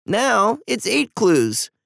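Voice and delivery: high, slow, sulky tearful voice; tearful sniffle. A narrator's reading. Eva correcting her brother after crying, tearful and sulky. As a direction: drag it out high, as if still crying. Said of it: "Eva sniffed".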